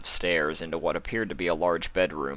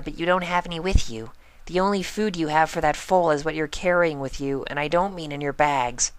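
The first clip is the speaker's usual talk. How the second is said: high, quick, serious pony voice; earnest Pinkie; 9 semitones higher than usual. Acted. Pinkie confiding in Spike, high and earnest. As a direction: confide a big secret, high and fast.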